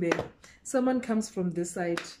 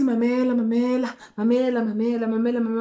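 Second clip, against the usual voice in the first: insistent pleading; 3 semitones higher, 4 dB louder, faster than usual. Retold or imitated. Guy pressuring the narrator to listen, loud and insistent. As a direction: keep pressing her to listen.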